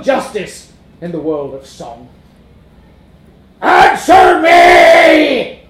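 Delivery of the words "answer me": very slow, low, loud roar; roaring monster. A narrator's reading. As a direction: roar it deep. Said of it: "roared the monster".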